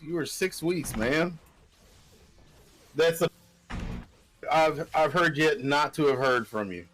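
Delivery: normal talking voice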